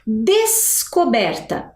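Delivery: "descoberta" said slowly, S and C heard apart; clear pronunciation. Shown as a demonstration. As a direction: draw out "descoberta" to show both sounds. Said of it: "Olha como eu consigo perceber a pronúncia". ("descoberta" devagar, S e C separados)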